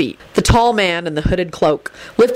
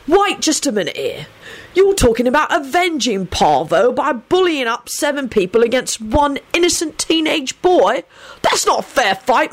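higher, indignant protest; defiant; 7 semitones higher than usual. A narrator's reading. Sirius challenges the gang's unfair revenge, defiant. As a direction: object defiantly, pitched up. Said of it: "Sirius announced defiantly".

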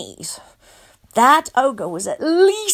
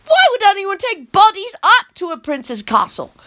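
plain reading voice

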